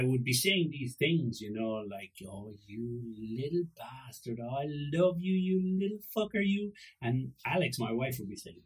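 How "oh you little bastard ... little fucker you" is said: doting coo that climbs in pitch; affectionate baby-talk swearing; loving insults. Retold or imitated. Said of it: "a place of love".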